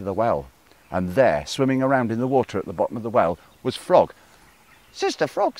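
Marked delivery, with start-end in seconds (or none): 4.9-5.6 very high crow call. Acted. Crow calling into the well, very high voice. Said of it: "said Crow".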